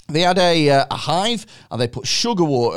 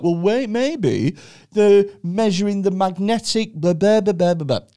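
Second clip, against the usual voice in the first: lightly mocked objecting scientist, pitched up; quibbling scientists; 6 semitones higher than usual. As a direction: raise a pedantic objection, tailing into nonsense syllables. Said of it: "other scientists were like".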